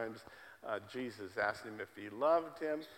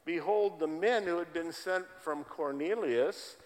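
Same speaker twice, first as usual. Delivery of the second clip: louder, declaiming Bible reading; scripture reading; 4 semitones higher, 5 dB louder than usual. Real, not acted. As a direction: proclaim the verse to the congregation. Reads scripture passage aloud.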